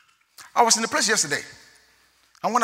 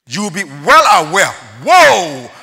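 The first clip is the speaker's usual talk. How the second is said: preaching at full force; 4 semitones higher, 14 dB louder, slower than usual. Acted.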